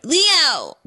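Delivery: put-on Valley girl squeal. Shown as a demonstration.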